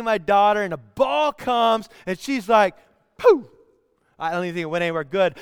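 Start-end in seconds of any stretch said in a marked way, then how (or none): 3.2-3.5 high, loud burst of "pooh"; imitated kick noise. Dad imitating his daughter's soccer kick, loud burst. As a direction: punch out a sudden high kick sound.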